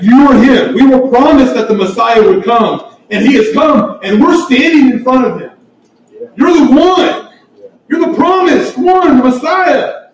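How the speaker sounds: high, loud, fervent preaching; preaching at full force. Real, not acted. Preacher exalting the Savior to the congregation, fervent and loud. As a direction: lift them up with awe in your voice.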